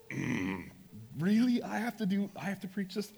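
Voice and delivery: high, disbelieving mock protest; incredulous. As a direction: play up the disbelief at your own assignment. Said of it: "And I'm like".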